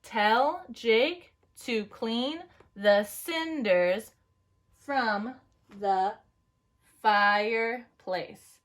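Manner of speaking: slow, one word per tap; tapping each word. Shown as a demonstration. Teacher tapping lines with the class. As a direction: pause between words as you tap. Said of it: "now let's tap our lines".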